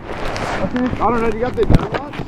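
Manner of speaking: loud. Real, not acted. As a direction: call out so the rider can hear. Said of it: "I, I can barely hear you".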